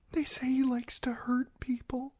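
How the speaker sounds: high, scared whisper; frightened whisper. Acted. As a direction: breathe it as a secret you fear. Frightened bride confides a rumor.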